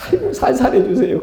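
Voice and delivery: high, hammed-up wince of a patient begging; mock pained plea. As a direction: playfully plead through pretend pain.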